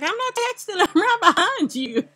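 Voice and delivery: high-pitched, laughing mock protest; retold protest. Player retelling reply to "stop texting", mock-indignant. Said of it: "I was like".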